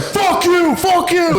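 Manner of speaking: high-pitched mock yelling match; retold yelled cursing. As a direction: imitate men hurling curses mid-scuffle. Two men shouting as fight starts.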